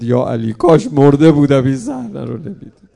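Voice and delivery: «کاش» cried out loud in a breaking voice, then the line falls away; grieving wail on «کاش مرده بودم»; calling out in grief. Retold or imitated. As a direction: lament «کاش مرده بودم» aloud with a crying voice. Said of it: "برگشت خونه صدا زد".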